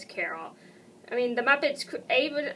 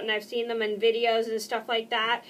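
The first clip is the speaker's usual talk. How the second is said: ordinary delivery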